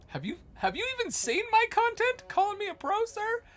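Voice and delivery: high-pitched, hammed-up outrage; mock indignation. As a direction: act offended, loud and squeaky. Mock outrage at being called pro.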